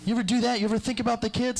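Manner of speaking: high, indignant lecturing voice; mock scolding. Angry neighbour scolding him over wasted water, indignant.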